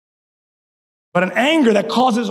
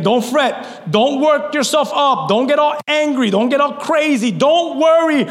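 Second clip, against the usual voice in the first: preaching at full force; 8 semitones higher than usual. Acted. Pastor urging the church, preaching at full force.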